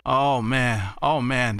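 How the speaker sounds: low, drawn-out amazement; floored. Real, not acted. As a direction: drop the pitch, stunned by the news. Floored by the ninety contacts.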